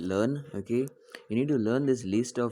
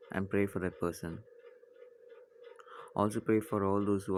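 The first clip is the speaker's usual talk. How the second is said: calm, slightly lowered prayer voice with long pauses; leading a prayer; 3 semitones lower, 5 dB quieter than usual. Real, not acted. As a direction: guide a class gently into silent prayer, growing softer to the Amen. Leads class in prayer.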